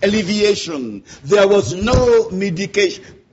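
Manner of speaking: loud, swelling pulpit preaching; preaching at full force. Acted. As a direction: build the sermon to a roaring climax.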